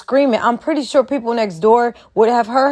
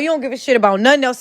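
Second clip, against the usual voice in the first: ordinary talk, nothing marked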